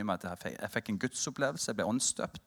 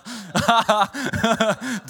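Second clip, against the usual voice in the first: loud laugh; 10 semitones higher, 10 dB louder than usual. Real, not acted. Friends joking about the past.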